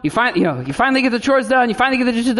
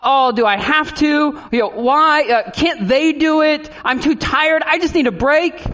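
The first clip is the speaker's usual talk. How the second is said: put-on whiny child; 6 semitones higher than usual. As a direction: whine like a tired kid. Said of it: "when they say".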